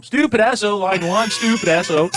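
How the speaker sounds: loud, high announcing a page over the store intercom; mock intercom page. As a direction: call the name out like a store PA page. New guy paging over intercom.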